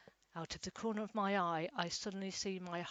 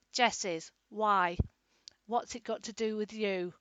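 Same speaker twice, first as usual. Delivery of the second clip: loud, hostile grunt of a reply; grunting carer; 2 semitones higher, 10 dB louder than usual. A narrator's reading. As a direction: bark it back much louder. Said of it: "grunts my horrible carer".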